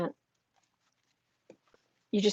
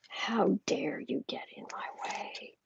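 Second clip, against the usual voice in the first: slow, softer whispered threat; menacing whisper; 9 dB quieter, slower than usual. Retold or imitated. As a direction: hiss it quietly like a furious boss. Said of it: "He is so angry".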